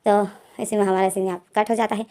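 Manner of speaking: ordinary delivery, nothing marked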